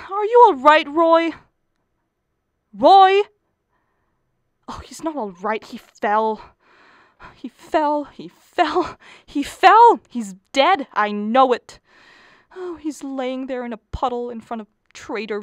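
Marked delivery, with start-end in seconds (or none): 0.1-3.2 loud, high frantic call; wife calling to husband on the ledge, frantic. Acted. 5.6-11.8 wife convinced her husband fell, growing hysterical; mounting hysteria. Acted. Hysterical repetition.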